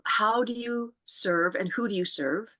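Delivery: usual speaking voice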